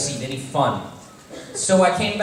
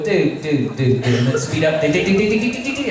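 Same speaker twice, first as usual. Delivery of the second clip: rhythmic do-do imitation of a rattling axle; car rattle noise. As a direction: mimic the clunking car noise.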